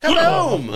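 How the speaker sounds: loud high shouted boom; explosion noise. Pretend. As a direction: shout it like a blast. Imitating the ship exploding.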